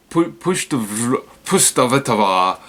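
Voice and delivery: Pustavitova sounded out slowly, syllable by syllable; slow careful retry. Real, not acted. Host retrying a hard Ukrainian name, slowly. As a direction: slowly sound out Pustavitova again after stumbling. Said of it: "I'm sorry, I'm not trying to make fun".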